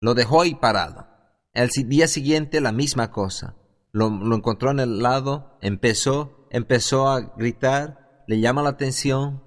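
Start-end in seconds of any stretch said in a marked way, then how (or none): none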